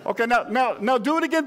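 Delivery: ordinary talk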